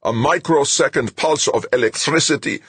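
high, loud ranting; enraged scientist ranting; furious roar. A narrator's reading. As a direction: rage at the absurdity of it. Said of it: "Zimmerman roared".

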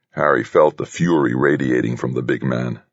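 ordinary delivery, nothing marked

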